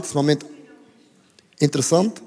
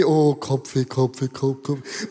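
low, fading mock moan; hammed-up coffee-withdrawal misery; headache moaning; 8 semitones lower, 3 dB quieter than usual. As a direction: repeat it feebly, sinking away. Acting first coffee-free morning of fast.

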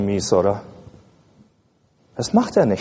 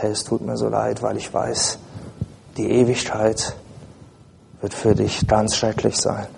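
sad Jesus; 5 semitones lower, 3 dB quieter than usual. Acted. Preacher voicing Jesus sorry for the one refusing, mildly sad. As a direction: lower and quiet, sorrowful.

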